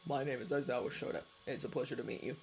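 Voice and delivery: low, soft and quick introduction; Shota's low voice. A narrator's reading. Cafe owner introducing himself to the tourist, low and quick. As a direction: rattle off his name, low and polite.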